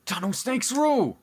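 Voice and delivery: high-pitched gang chant; rallying cheer. Pretend. Co-host cutting into the lore with the gang's slogan, rallying cheer.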